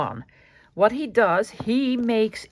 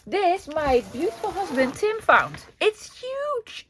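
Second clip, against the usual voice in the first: excited; 9 semitones higher, slower than usual. Real, not acted. Showing off a huge book.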